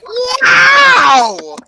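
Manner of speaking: piercing high parrot shout; very loud. Retold or imitated. Imitating a relative's bird, very loud shout. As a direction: screech it out long, very loud. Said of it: "very loudly like this".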